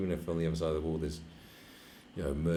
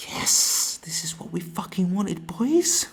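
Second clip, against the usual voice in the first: much higher, louder gleeful voice; mock delighted official; 12 semitones higher, 8 dB louder, slower than usual. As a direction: gush like a thrilled diplomat, pitched up. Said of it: "the U N will just go".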